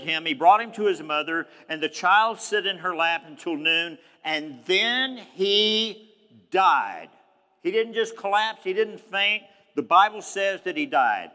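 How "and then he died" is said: slow suspense before the final word; dramatic pause. Acted. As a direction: stretch it out and let died fall. Dramatic reveal of child's death.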